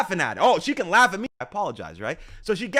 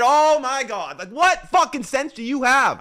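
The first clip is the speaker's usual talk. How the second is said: loud, raised-pitch rant; exasperated outrage; 5 semitones higher, 6 dB louder, slower than usual. Real, not acted. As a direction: burst out in real exasperation. Same rant, clip replayed.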